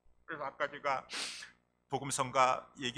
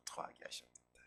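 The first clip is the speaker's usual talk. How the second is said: 토하게 하셨도다 echoed in a whisper; 14 dB quieter than usual. Shown as a demonstration. Preacher echoing the verse to the congregation, whispered. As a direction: breathe 토하게 하셨도다 softly after the shout.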